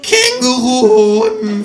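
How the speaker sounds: very high, loud, drawn-out shriek; startled out of trance. A narrator's reading. Kangaroo jolted by hearing kangaroo testicles, shrieking. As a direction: jolt awake with a horrified squawk. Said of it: "fährt das Känguru aus seiner Trance empor".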